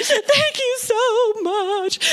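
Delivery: high, drawn-out tearful thanks; imitated weeping. Weeping callers thanking the minister on the phone, imitated crying. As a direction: mimic someone weeping with gratitude. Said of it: "they're crying and they're weeping".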